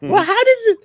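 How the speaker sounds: high squeaky voice; helium voice. Pretend. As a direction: talk in a tiny chipmunk voice. Same high voice continues.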